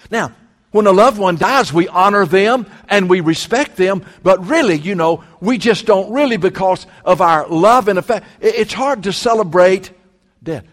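usual speaking voice

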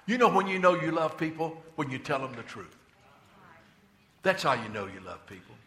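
ordinary delivery

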